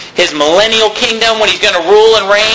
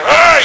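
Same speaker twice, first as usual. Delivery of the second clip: mock frustrated groan; 8 semitones higher than usual. Mocking stressed anger.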